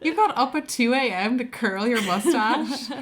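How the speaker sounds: high-pitched, laughing mock disbelief; mock incredulity. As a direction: act scandalised, half laughing, pitch jumping up. Mock outrage at dad's curled mustache.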